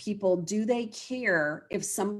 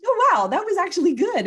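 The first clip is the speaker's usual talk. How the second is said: high, loud imitated amazement; wow-struck mimicry, much higher and louder; surprised client quote; 11 semitones higher, 7 dB louder than usual. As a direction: act out a client's pleased discovery. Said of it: "they're usually like".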